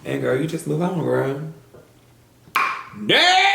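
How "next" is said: high, loud "Next!"; sharp high shout of dismissal; loud call. Real, not acted. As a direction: wave the next one in, loudly. Brushing off, calling next.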